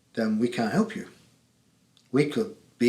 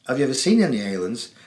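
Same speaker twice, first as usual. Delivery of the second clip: ordinary talk, nothing marked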